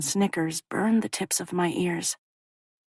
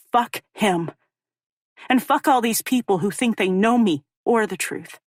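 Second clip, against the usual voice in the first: angry; 4 semitones higher, 4 dB louder than usual. Acted. Furious inner outburst.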